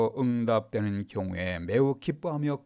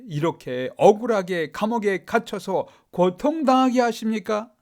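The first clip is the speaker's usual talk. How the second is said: raised, pleading protest with a bitter edge; aggrieved complaint to God; 6 semitones higher, 4 dB louder than usual. Retold or imitated. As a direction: voice Paul's hurt grievance, higher and louder. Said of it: "이렇게 항의했나요?". (억울하게 항의하는 높은 목소리)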